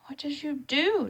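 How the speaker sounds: urgent, insistent question; demanding. Acted. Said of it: "Thomas demanded".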